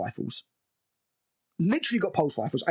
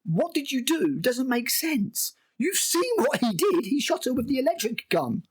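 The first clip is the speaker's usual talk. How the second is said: exasperated disbelief; 9 semitones higher than usual. Real, not acted. Reviewer arguing that Wendy's line makes no sense, exasperated. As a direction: protest it in a high, baffled wail.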